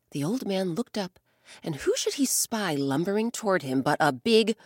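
ordinary delivery, nothing marked

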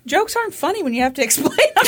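fast, high, loud through laughter; laughing protest. Real, not acted. Co-host explaining her own failed joke, laughing shout. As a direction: protest while cracking up.

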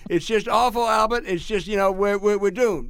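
put-on high-pitched Louisiana accent, lamenting to a friend; mock doom in an imitated Louisiana accent; James Carville. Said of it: "this is my Louisiana accent".

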